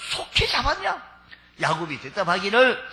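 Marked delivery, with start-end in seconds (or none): 0.1-1.0 much higher, half-laughing astonished question; amazed old father. Acted. Preacher as Isaac marveling at his son's quick hunt. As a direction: marvel at how fast he caught it. Isaac asks how so fast.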